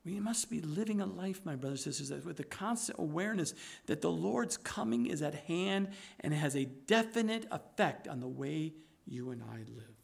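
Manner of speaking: soft, low, intimate sermon voice; hushed preaching. Acted. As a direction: draw them in close and quiet.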